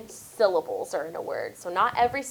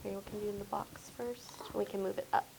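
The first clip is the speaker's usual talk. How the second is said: hushed, voiceless aside; whispering; 14 dB quieter, faster than usual. Real, not acted. Teacher helping a pupil with the box, whispered.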